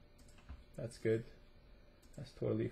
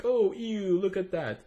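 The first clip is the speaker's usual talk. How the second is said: higher-pitched mock "ew" reaction; acted disgusted reaction; 7 semitones higher, 5 dB louder, slower than usual. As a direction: act out recoiling at an ugly picture. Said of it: "I'd be like".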